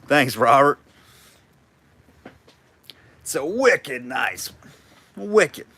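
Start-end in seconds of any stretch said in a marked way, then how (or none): 3.2-5.7 drawn-out imitation Boston accent. Auctioneer teasing a bidder from Mass, mock Boston accent. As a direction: put on a playful Massachusetts accent. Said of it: "Love that accent".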